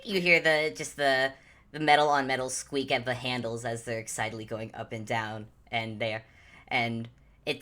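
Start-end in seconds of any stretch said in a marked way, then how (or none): none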